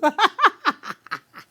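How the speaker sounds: loud, high cackle. Real, not acted. Cracking up at the quoted lover, high cackle. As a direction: burst into a shrieking laugh.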